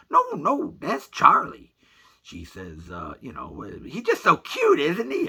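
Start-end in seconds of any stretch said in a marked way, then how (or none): 0.1-1.7 very high, loud reassurance; high-pitched mock female voice; insistent groomer. Groomer insists it is his dog. 3.5-5.3 high, loud, drawn-out cooing; imitating an over-delighted groomer; gushing groomer. Groomer gushes over shaved dog.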